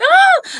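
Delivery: high, loud shriek of joy; joyful scream. Retold or imitated. Seeing she passed the test, high joyful scream. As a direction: shriek as the news lands. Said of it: "I screamed".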